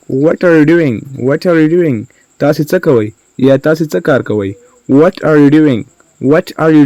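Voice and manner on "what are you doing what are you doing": ordinary talk, nothing marked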